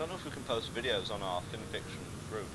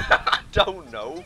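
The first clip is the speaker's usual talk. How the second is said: slow, loud, laughing; 6 semitones higher, 13 dB louder, much slower than usual. Real, not acted. Asked about a weird sound, loud slow laughing reply. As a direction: giggle it out. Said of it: "What was that sound you just made".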